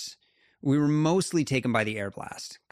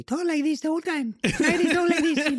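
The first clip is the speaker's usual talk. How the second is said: very high, squeaky impression of an Italian climber; Simone Moro; 5 dB louder than usual. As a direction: imitate him in a piercing, high cartoonish voice. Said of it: "Simone has this voice that is just epic".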